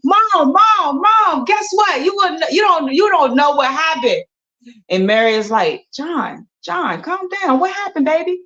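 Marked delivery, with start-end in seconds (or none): 0.0-4.2 excited. Acted. 5.9-8.4 calm him down gently; soothing mom. Acted. Mom soothes excited son.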